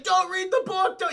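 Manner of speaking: high, loud warning yell; mock panicked warning. Egyptologist warns the others about the book, mock panicked. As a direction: shout a frantic warning in imitation.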